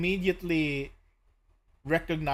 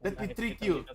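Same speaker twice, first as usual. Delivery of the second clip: higher, hammed-up Italian accent; spaghetti accent; 4 semitones higher than usual. Grateful NPC invites the party to eat, hammed-up Italian accent. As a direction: welcome them in like a jolly Italian host. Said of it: "he has that spaghetti accent".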